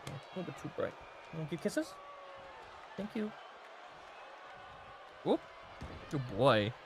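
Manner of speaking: soft, low cooing to the dog; quietly coaxing the dog for kisses; pet talk. Real, not acted. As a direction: hush it like soothing a pup. Talking softly to his dog.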